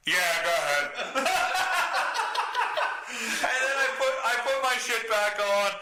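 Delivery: giggling as you tell it; half-laughing at his own story. Real, not acted.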